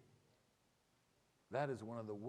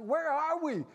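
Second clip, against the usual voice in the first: put-on high, loud girlish voice, much higher than usual; girl's voice; 9 dB louder than usual. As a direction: play the excited young woman, high and bright. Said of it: "She's like".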